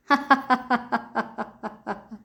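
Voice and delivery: hearty open laugh; a long, free belly laugh; burst of laughter. Pretend. Laughter yoga session, fake phone call.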